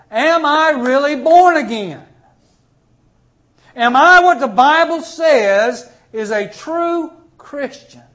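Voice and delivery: high, loud self-searching cry; loud pleading question. Acted. Preacher voicing a believer questioning himself before God, loud plea.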